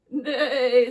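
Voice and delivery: very loud, quivering whinny; whinny it out like a horse; horse neigh. Acted. Horse answers the mask riddle, loud neigh. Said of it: "says the horse".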